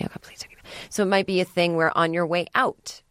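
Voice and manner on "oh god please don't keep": whispered desperate plea, trailing off. Retold or imitated. Woman at a bar silently begging a stranger to stop, whispered. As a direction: breathe the words with no voice at all. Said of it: "She might be sitting there going".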